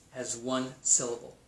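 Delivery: ordinary delivery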